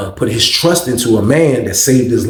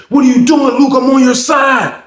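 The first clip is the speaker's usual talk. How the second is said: mock exasperated plea; 11 semitones higher, 3 dB louder than usual. Randall pulling back up on Luke, mock exasperated plea. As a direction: appeal to him, pitched way up and slowing.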